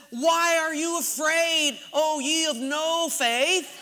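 high, slow scriptural rebuke; mock preaching. Husband turns to fearful wife, mock-biblical rebuke. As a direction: intone it like grand scripture, drawn out.

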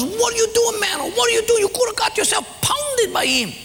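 very high, frantic scolding; panicked friend. Acted. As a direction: squeal in alarm at a reckless friend. Friend panics at the laughing man.

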